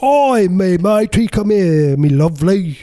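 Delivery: high, loud, drawn-out bad Cockney; hammed-up Cockney. Parodying the movie's chimney sweep, over-the-top Cockney accent. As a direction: belt out a stagey Cockney greeting. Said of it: "Cockney people didn't speak that way".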